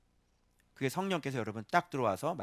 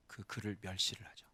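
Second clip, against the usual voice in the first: breathy hushed whisper; 6 semitones lower, 9 dB quieter than usual. Real, not acted. Preacher whispering about people despising the word. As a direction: drop to a whisper.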